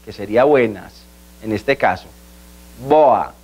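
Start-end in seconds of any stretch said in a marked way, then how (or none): 2.9-3.3 BOA as "BOH-ah"; Portuguese pronunciation. Shown as a demonstration. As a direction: stress the o in BOA, light final a. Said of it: "la pronunciación de boa".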